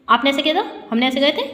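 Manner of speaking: quick, run-together phrases; rushed speech. Shown as a demonstration. Teacher showing pupils what won't be understood, rushed. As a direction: rattle it off without pauses between words. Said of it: "हमने ऐसे बोला".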